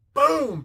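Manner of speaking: loud, high boom of impact; imitated pounding. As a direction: slam out one sudden boom. Imitates Spider-Man pounding Goblin.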